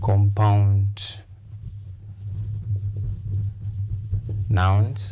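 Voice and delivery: "Compound" and "nouns" said as separate words with a pause between; stress on COMpound. Shown as a demonstration. Teacher to English class, showing the stress in a compound noun. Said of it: "you stress the first part".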